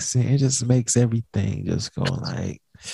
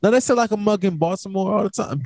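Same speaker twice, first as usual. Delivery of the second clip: higher, animated protest; excited insisting; 7 semitones higher, faster than usual. Real, not acted. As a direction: pitch up and push back hard. Insisting in argument over slang.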